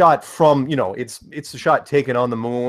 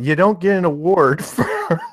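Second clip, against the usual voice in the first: laughing; 4 semitones higher than usual. Real, not acted. Joke about nice globalist.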